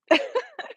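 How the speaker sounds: high happy laugh; high delighted laugh. Real, not acted. Streamer laughing because chat heard her, high happy laugh.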